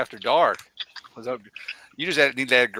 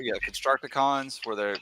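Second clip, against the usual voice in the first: ordinary talk, nothing marked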